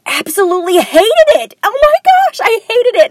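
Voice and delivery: high, loud exasperated outburst; emphatic loathing. Real, not acted. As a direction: burst out with how much you loathed it. Recalling how she hated nursing college.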